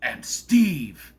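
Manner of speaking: very slow, drawn-out imitation; mock-momentous trailer introduction; movie Steve. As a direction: announce it grandly, stretching the last word. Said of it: "So the way he says".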